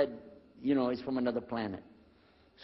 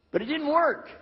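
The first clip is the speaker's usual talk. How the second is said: comic high exclamation; 12 semitones higher, 5 dB louder than usual. Real, not acted. Punchline after high aspirations.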